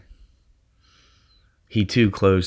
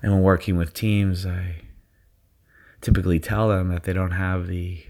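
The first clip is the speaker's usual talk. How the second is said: guided meditation. Acted. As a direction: ease them into their zone. Leads a guided visualization.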